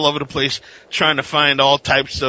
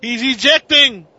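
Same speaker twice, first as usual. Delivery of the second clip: high, loud mock announcer shout; mock action alarm; 12 semitones higher, 4 dB louder than usual. Cohost mocking him quitting Avengers, in a high mock alarm. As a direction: cry it out like a pilot bailing out.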